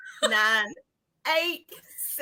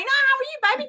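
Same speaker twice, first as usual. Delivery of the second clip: put-on baby talk; 13 semitones higher, 4 dB louder, faster than usual. Greeting the decoration.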